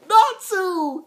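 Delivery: high, loud announcement of the name; excited reveal. Real, not acted. A reviewer revealing who broke in, excited loud call. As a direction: reveal the intruder with a burst.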